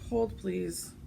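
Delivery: usual speaking voice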